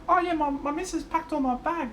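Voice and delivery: very high, excited mate's impression; high-pitched goofy mate voice; the Blythe voice. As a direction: squeak it out like a clueless, delighted bloke. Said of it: "The Blythe voice".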